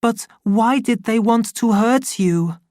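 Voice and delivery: put-on a high, hurt little boy's voice; child's question. The boy asks his mother why they hurt her, bewildered. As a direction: ask it like a small bewildered boy. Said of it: "he asked that night".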